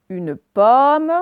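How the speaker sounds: "pomme" with a long, loud open /ɔ/. Shown as a demonstration. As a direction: stretch the open vowel in "pomme". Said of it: "avec le O ouvert". (« pomme » avec un O ouvert appuyé)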